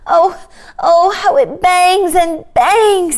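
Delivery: put-on a girl fretting over her aching ear; pained little girl. Jane complains about her sore ear, high and slow. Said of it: "clutching her ear again".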